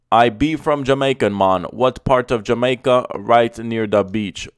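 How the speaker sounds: put-on loud, jokey Jamaican accent. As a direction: play it up as a Jamaican local. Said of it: "I read it in my American accent and it didn't sound right, so I, I had to do it".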